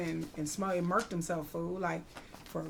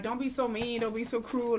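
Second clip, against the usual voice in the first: ordinary delivery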